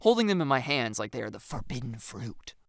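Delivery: plain reading voice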